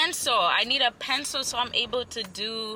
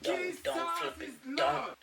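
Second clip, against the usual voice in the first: high plea; 6 semitones higher, 7 dB quieter than usual. Real, not acted. Pleading with stylist.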